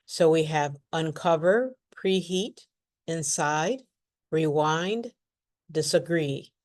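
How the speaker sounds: uncover, preheat, inside, rewind, disagree with the prefix unstressed and the root stressed; stress on the root, not the prefix. Shown as a demonstration. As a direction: lean on the root syllable of each word. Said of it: "Notice how I do not stress the prefix".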